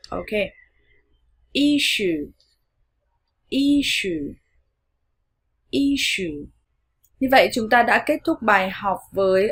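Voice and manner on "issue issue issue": "Issue" high first syllable, long falling ending; long U drill. Shown as a demonstration. Teacher modelling the last practice word, "Issue" high then falling. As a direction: hit "Issue" high, then stretch the oo downward. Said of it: "luyện tập nói các từ sau".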